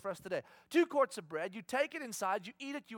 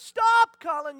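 put-on sudden loud yell to a child; a parent's alarmed shout; shouting stop; 12 semitones higher, 15 dB louder, slower than usual. Dad shouting at running child.

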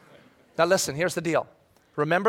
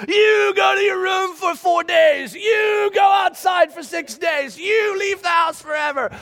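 put-on high, loud furious yelling at the kids; mock angry screaming; 12 semitones higher, 4 dB louder, slower than usual. Father screaming at his kids in a hypothetical blowup, loud and high. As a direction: yell like a parent who has lost it. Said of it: "I'm mad and I'm screaming".

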